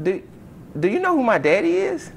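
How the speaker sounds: loud, very high mock outrage; indignant boast. Student pulls rank on the coach, indignant.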